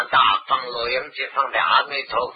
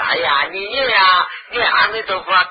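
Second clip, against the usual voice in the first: imitating bystanders loudly pushing the dying to chant; mock loud urging; 5 semitones higher, 6 dB louder than usual. Bystander loudly urging a dying man to chant. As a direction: call out 念阿弥陀佛啊 like a pushy relative. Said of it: "一般人说".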